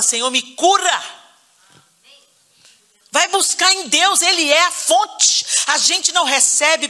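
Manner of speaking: high, impassioned pulpit exhortation; full-force preaching. Real, not acted. Preacher urging the church to pray for healing from bitterness, full-force preaching. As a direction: push each plea upward with urgency.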